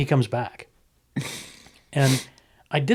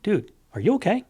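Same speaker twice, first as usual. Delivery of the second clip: concerned bouncer; 9 semitones higher than usual. Retold or imitated. Bouncer voicing his gentle approach.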